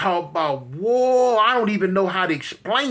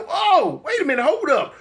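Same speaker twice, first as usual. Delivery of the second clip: high alarmed oh then hold up; startled plea to stop; sudden high panicked hold up; 8 semitones higher than usual. Retold or imitated. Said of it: "I'm like".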